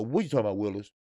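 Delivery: lightly voiced low child-star catchphrase; Arnold impression. Quoting Little Arnold to mock the writer, low and lightly voiced. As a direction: drop low and toss off the famous catchphrase. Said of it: "Little Arnold".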